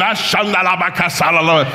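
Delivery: high, hurried-sounding mock tongues; praying in tongues. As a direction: break into comic tongues mid-tirade. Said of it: "I start praying in tongues".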